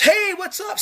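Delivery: high goofy casual dude voice; high mocking bro voice; mock Jesus voice. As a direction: play him as a laid-back surfer dude.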